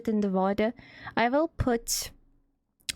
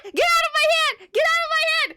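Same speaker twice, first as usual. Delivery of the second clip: high, loud frantic yell; real frantic shouting; 6 dB louder, much faster than usual. Real, not acted. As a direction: yell it like the chat is driving you mad. Shouts at chat messages.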